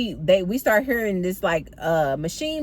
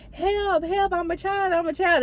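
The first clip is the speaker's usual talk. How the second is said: very high mock cry for help; fake child's voice; 10 semitones higher than usual. Mystery voice pleading from inside the bubbles, high fake child voice. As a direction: pipe up like a pretend child in trouble. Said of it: "you hear this voice".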